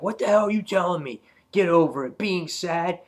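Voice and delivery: high-pitched, indignant retelling of an outburst; pissed off. Retold or imitated. Alan snaps back at Andrea's advice, pissed off. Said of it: "all pissed off".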